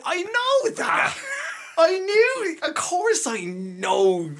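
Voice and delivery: very high, drawn-out mock wail; exaggerated, slow squeal of feigned knowing; over-the-top Irish protesting. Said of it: "I did the Irish thing".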